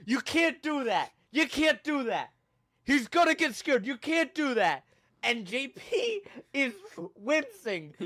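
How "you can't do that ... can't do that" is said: higher-pitched, insistent retold telling-off; lightly acted frantic admonishing; urgent scolding. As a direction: imitate someone urgently reprimanding a friend. Retold man scolding friend for reacting.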